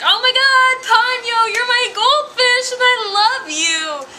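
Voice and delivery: high, loud hammed-up gushing; overjoyed kid. Kid greets his returned goldfish, mock ecstatic. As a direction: squeal it like a thrilled little boy, imitated. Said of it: "the kid is like".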